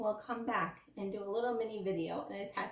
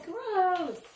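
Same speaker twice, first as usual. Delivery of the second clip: put-on high, loud, drawn-out child's disgust; 10 semitones higher, 8 dB louder than usual. A narrator's reading. Danny learning about tooth poop, disgusted. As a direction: squeal it out as if grossed out. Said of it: "Danny crinkled his nose and said".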